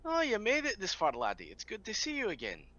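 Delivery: put-on a sudden high, loud hello to an old friend; cheery greeting. Biggin greeting his old wolf friend, cheery and high. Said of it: "I'll say".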